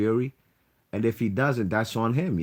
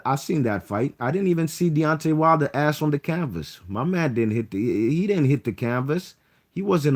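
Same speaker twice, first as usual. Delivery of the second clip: raised, emphatic protest; heated outburst; 6 semitones higher, 4 dB louder than usual. Real, not acted. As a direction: push it out, loud and insistent. Heated protest about the fight.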